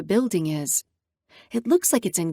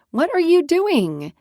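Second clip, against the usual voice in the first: exasperated question; 8 semitones higher, 5 dB louder, slower than usual. Acted. Libby pokes her head in.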